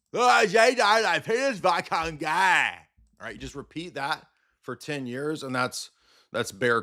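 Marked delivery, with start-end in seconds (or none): 0.1-2.7 high, slow simpering parody; mock fan voice. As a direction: gush like a dim fan. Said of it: "that's my impression of bear comedy".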